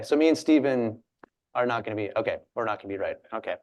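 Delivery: ordinary talk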